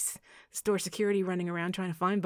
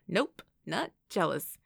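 slow, measured denial; protesting denial; 4 dB louder, slower than usual. A narrator's reading. Evan insists he is not jealous, protesting slowly. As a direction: back off slowly while still denying it. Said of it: "Evan protested, putting his hands up".

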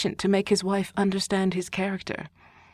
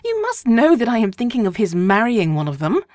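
higher, louder scolding; exasperated wife; 4 semitones higher, 7 dB louder than usual. A narrator's reading. Wife scolding husband as tiresome, higher voice. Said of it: "replied his wife".

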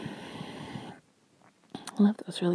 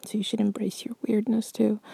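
ordinary talk, nothing marked

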